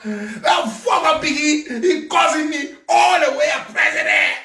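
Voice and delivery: hammed-up outrage; mocking rant. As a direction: yell it, laughing scorn. Mocking shout about leader.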